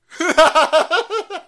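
falling loud laugh. Real, not acted. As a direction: crack up. Laughing at the quote.

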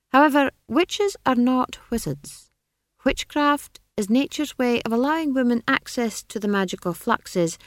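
high, condescending wizard voice; be an amused wizard humouring a child; lofty, high-pitched dismissal; patronizing wizard. A narrator's reading.

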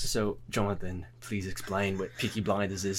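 low, soft British host; put-on low British accent; harsh British voice. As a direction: hand over in a deep British voice. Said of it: "a harsh British voice".

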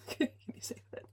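amused whisper through a laugh. Real, not acted. Host laughs at her own mistake, amused laughing whisper. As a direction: laugh at your own slip and whisper the question.

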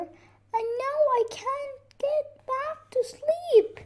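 very high, plaintive voice; tearful complaint. Acted. Weeping bear cannot sleep, high plaintive complaint. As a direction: whimper high, feeling sorry for yourself. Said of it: "cried the bear".

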